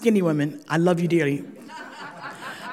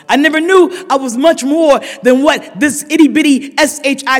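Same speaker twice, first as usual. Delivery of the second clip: raised, slowed, pleading confession of pain; impassioned testimony; 4 semitones higher, 4 dB louder than usual. Real, not acted. Heartfelt testimony at full force.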